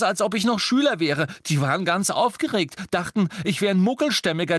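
high, urgent storytelling; agitated account. A narrator's reading. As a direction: pour out the story in alarm. Ron tells of his capture.

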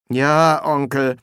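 mildly dejected reply; resigned nephew, understated; discouraged. Acted. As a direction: give in, a touch disheartened. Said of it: "antwortete Soll entmutigt".

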